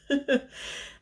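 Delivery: breathy laugh. Real, not acted. Laughing about being out of breath.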